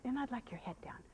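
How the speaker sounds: hushed whisper request; soft whisper. Real, not acted. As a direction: ask it in a whisper. Asking horse to lower head.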